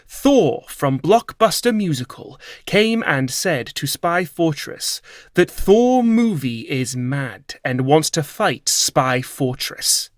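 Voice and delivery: plain reading voice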